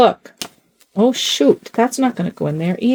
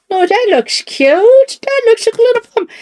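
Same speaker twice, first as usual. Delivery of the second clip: cooing; 14 semitones higher, 3 dB louder than usual. Pretend. Cooing to doll.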